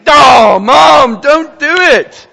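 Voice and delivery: high, loud cry of dismay, slowing at the end; pleading shout. Retold or imitated. Onlooker begging the mom not to cave, loud high plea. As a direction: beg her not to give in. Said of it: "I'm like".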